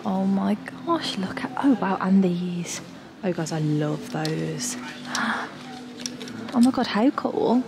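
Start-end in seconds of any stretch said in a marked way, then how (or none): none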